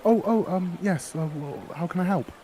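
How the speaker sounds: put-on a cheerful girl pushing her flowers; eager flower girl. Flower girl offering her flowers to the adventurer, high and eager.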